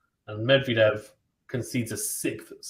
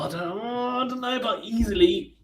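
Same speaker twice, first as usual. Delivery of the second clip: doubtful protest; 10 semitones higher than usual. Real, not acted. Commentator disputes a viewer's "easily", doubtful. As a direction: push back in a high, sceptical voice.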